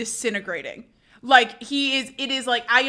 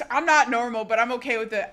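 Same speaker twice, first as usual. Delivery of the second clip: ordinary delivery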